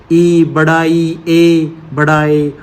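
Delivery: slow, stretched vowels, one by one; slow vowel demo. Shown as a demonstration. Teacher to students, slowly stretching unrounded vowels. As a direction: lay out each vowel slowly for the class. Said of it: "जैसे कि".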